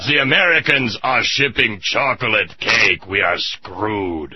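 slow, grim German officer; a hint of a German commander; German accent. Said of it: "and he says".

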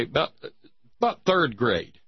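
normal talking voice

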